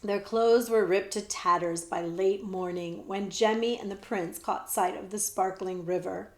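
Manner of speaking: plain reading voice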